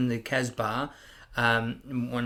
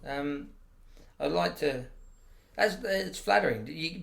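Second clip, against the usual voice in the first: ordinary talk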